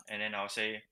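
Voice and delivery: ordinary delivery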